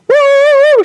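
high, loud excited whoop for the dog; dog's joy. Retold or imitated. Host voices her dog's reaction to the park, loud high whoop. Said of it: "she's like".

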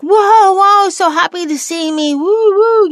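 high, loud, gushing whoa whoa; excited dog greeting. Retold or imitated. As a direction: burst with puppy joy at the door. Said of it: "he's like".

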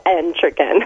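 Entrakin said EN-tra-kin, weight on EN; stress on the first syllable. Shown as a demonstration. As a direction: lean on the EN of Entrakin. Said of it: "with an emphasis on the first syllable".

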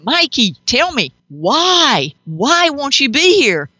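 high, drawn-out pleading to a lost husband; retold tearful plea. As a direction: beg him why, voice climbing. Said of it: "and I said to him".

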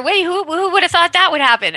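high, baffled dog voice; a puzzled dog thinking aloud. Retold or imitated.

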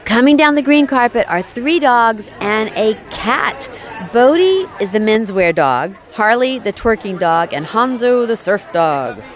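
announcer voice. Acted. Announcing arrivals on carpet.

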